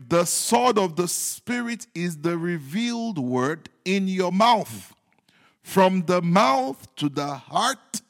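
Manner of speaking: normal talking voice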